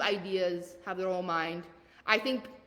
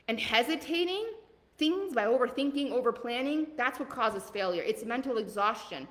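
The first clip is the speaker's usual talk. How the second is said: ordinary delivery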